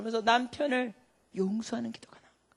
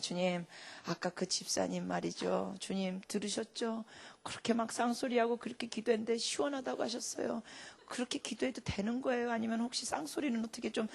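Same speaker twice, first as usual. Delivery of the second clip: quiet prayer; 11 dB quieter than usual. Retold or imitated.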